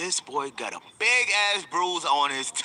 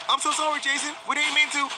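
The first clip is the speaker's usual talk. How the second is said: scrambling to apologize before trouble hits; panicked apology; 11 semitones higher than usual. Retold or imitated. Brother pleading with Jason, panicked and high.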